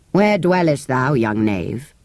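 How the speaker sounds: low, archaic soldier; gruff soldier. Acted. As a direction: question him sternly.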